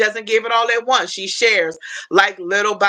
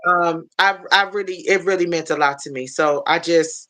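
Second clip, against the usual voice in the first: usual speaking voice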